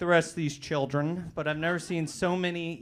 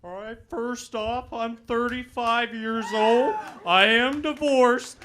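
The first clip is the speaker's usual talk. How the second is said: slow, loud, higher motivational-speaker bellow; Chris Farley impression; 8 semitones higher, 12 dB louder, slower than usual. Comic opening set with a slow Chris Farley bellow. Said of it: "that's my impersonation of Chris Farley".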